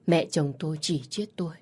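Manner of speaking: plain reading voice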